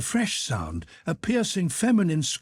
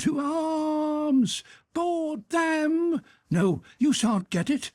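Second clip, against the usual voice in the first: put-on high, wild cry of a half-crazy old man; hoarse scream; much slower than usual. A narrator's reading. Drunken old servant screams at the porters, high and wild. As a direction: scream it like a drunk hero charging. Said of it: "screamed hoarsely".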